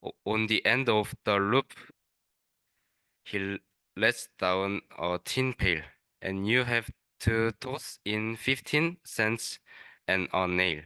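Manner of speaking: usual speaking voice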